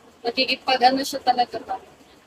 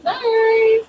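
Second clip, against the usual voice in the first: cheery high goodbye; 9 semitones higher, 6 dB louder than usual. Real, not acted. Calling goodbye to the stream.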